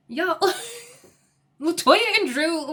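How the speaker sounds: laughing through the names; can't keep a straight face; amused disbelief. Real, not acted. Laughs at the confusing pair.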